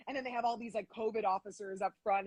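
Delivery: ordinary delivery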